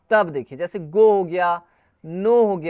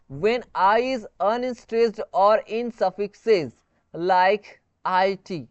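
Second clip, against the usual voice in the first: slow rule reading; much slower than usual. Shown as a demonstration. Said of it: "जब आई जो है ना बिना स्ट्रेस के यूज़ होता है".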